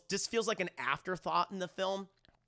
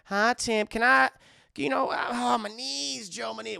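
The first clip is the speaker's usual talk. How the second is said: flirty auntie; 6 semitones higher, 8 dB louder than usual.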